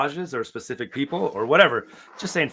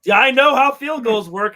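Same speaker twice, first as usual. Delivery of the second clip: ordinary talk, nothing marked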